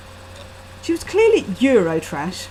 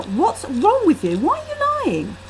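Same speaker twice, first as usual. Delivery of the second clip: angry accusation; 10 semitones higher than usual. A narrator's reading. Heated quarrel with friend.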